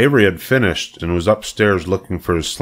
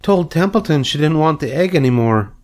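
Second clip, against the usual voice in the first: put-on higher little-girl voice; Fern; 9 semitones higher than usual. A narrator's reading. Fern repeats herself to her mother, high child voice. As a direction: repeat it patiently, pitched up like a child. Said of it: "repeated Fern".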